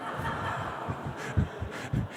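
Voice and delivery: quiet breathy chuckle; soft laugh. Real, not acted. Teller enjoying the joke's twist: hushed breathy laugh. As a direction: laugh under your breath at the punchline.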